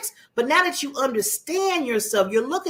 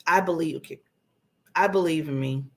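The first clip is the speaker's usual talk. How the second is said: low, unsure, halting delivery; low-pitched, half-hearted self-belief; unconvinced; 6 semitones lower than usual. Shown as a demonstration. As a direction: say it like somebody told you to. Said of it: "when I say it like that, does that sound confident?".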